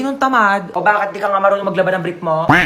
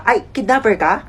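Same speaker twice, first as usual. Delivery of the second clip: ordinary talk, nothing marked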